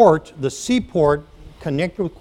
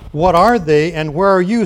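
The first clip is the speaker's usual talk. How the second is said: louder, excited outcry of a startled pig; scream; 4 semitones higher, 8 dB louder than usual. A narrator's reading. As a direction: call out loud and bewildered. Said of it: "screamed Wilbur".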